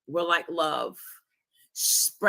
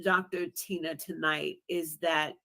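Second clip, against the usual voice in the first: ordinary talk, nothing marked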